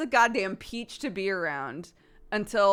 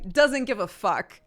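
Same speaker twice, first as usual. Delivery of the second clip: ordinary talk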